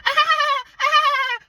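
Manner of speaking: very high loud cackling laugh; shrill laugh imitation. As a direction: mimic a piercing obnoxious cackle. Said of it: "her laughter was like".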